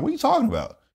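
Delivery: high, baffled question; confused disbelief. Retold or imitated. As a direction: ask it like the ground just vanished. Retold confusion, repeated higher.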